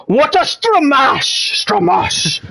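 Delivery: Scottish commentator, slow and drawn out on stramash; a slow, hammed-up Scottish commentator's cry. Commentator recalling an old Scottish pundit, slow Scottish exclamation. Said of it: "and he would go".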